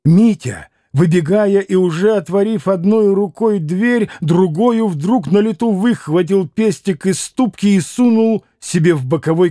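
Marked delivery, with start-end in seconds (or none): none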